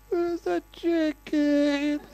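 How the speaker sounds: hammed-up, high, slow tearful squeal; near tears. Wife losing the argument with her husband, mock tearful whine. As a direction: squeak it out slowly, as if about to cry. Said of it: "she was about to burst into tears".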